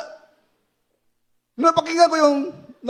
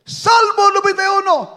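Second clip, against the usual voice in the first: shout; 6 semitones higher than usual. Retold or imitated. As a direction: cry it out a third time. Shouting the psalm third time.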